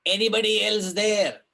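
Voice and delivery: hammed-up plea into the dark; crying out. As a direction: shout out into the dark for anyone else. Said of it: "cries out".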